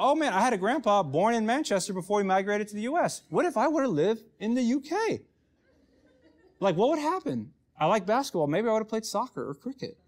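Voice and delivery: giddy daydream. Retold or imitated. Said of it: "daydream and pray in a British accent".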